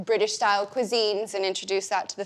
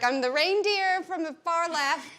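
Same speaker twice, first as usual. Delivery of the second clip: higher, eager self-pointing; playful announcing; 6 semitones higher than usual. Retold or imitated. Guest joking about her tiny TV role, playful announcing. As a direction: point yourself out in a crowd, amused.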